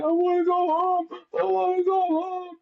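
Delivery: high whiny imitation of a crying child; crying little kid. Mocking the crying kid at the race, high whiny plea. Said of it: "he starts crying".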